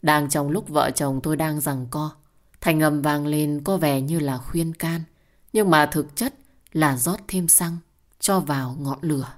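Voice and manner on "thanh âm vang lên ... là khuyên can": plain reading voice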